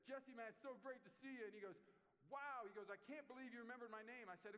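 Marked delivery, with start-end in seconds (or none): 0.1-1.5 high, loud and delighted; a booming, happy welcome; loud greeting. Retold or imitated. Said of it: "we're talking loud out in the lobby". 2.3-2.7 a loud, high astonished wow; shouting wow in disbelief; amazed shout. Retold or imitated. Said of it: "Just shouts it loud". 2.9-4.3 high, loud and overwhelmed; a loud, grateful exclamation; loud amazement. Retold or imitated. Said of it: "Just shouts it loud".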